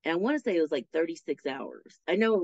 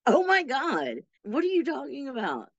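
shocked disbelief; 8 semitones higher than usual. Retold or imitated. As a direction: retell it as if jolted by the news. Reacting to bunkie's grim joke.

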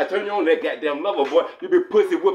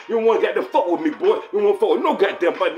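mock threat; 3 semitones higher, 3 dB louder than usual. Threatening tough-guy boast.

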